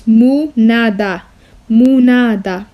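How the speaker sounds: "منادى" said as mu-na-da, each beat clear; split into syllables. Shown as a demonstration. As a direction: break منادى into three beats. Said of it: "Divide this, just like this".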